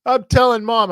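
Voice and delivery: put-on high, loud bratty little-kid yell; whiny kid brother yell. Little brother locked in the bathroom yelling back, bratty and loud. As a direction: scream it like a hurt little brother. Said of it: "And he's yelling".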